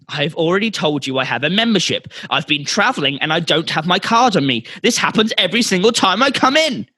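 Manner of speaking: hammed-up outrage, climbing in pitch; mock screaming. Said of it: "customer more screaming".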